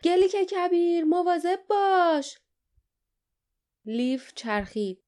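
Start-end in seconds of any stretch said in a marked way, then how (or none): 0.0-2.4 high, loud cry of alarm; warning shout. A narrator's reading. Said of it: "صدای فریادی سکوت را شکست".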